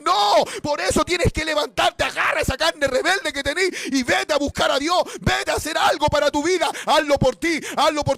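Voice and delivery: shouted, high, loud preacher's exhortation; full-force preaching. Acted. Preacher shouting at the lazy believer to get up. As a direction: preach it hard, shouting at the sinner.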